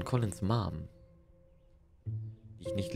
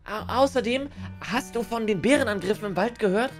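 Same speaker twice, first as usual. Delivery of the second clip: put-on high, bright cutesy girl voice; chirpy anime girl teasing; cute anime streamer; 12 semitones higher than usual. Voices cheerful game girl.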